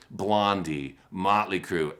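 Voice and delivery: normal talking voice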